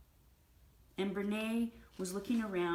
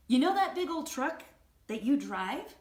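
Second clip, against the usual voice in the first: loud, high mock-innocent setup; pointed comeback; 6 semitones higher, 9 dB louder than usual. Wife baiting husband about his truck, pointedly. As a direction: set him up for a dig.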